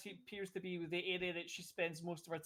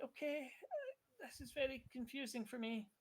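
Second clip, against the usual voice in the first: soft confused voice; 6 semitones higher, 8 dB quieter than usual. Acted. NPC voiced by GM.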